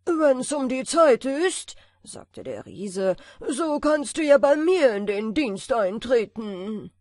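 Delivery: loud booming giant; big, bossy giant speaking down. A narrator's reading. Giant offers the tailor work. (laute Riesenstimme)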